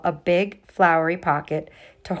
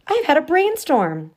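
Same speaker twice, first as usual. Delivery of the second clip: high, louder eager announcement; proud to share a big idea; excited announcement; 9 semitones higher, 6 dB louder than usual. Acted. Kendall telling the girls her brainstorm, excited.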